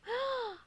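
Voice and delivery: high excited gasp; delighted gasp. Real, not acted. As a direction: gasp with glee. Reacts to character line.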